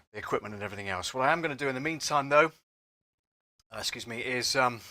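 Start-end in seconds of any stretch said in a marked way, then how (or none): none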